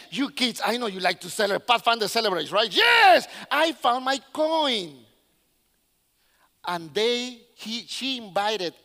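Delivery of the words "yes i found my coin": jubilant cheer. Acted. Woman celebrating her found coin with kids, jubilant. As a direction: cheer as if winning, then stretch it out.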